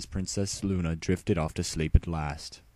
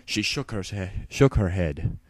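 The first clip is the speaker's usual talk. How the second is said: plain reading voice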